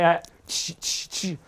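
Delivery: usual speaking voice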